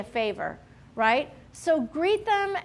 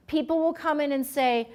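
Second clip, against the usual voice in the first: normal talking voice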